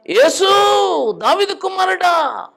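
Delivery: loud, high, slow shout for help; desperate shout. Retold or imitated. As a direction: cry out to Jesus with all your strength. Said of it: "అట్లా కేకలేస్తాడు". (యేసును గట్టిగా కేకవేయడం)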